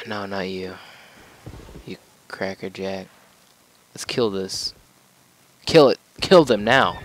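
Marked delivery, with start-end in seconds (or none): 5.7-7.0 loud, high battle command; shouted order. Real, not acted. Gamer yelling at his troops to attack, loud shout. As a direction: bark the kill order.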